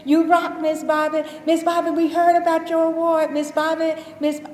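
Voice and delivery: put-on high, loud, chirpy children's voices; kids greeting her. Students greeting the custodian every morning, high, loud and cheerful. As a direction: imitate the kids calling out to her all day, high and loud. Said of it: "I hear Miss Bobby all day long".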